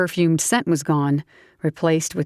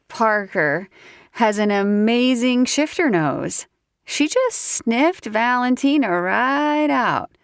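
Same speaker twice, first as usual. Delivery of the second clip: put-on higher, giggly drunk southern woman; tipsy southern woman; 5 semitones higher than usual. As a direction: play the tipsy belle teasing him. Tipsy Grace's voice.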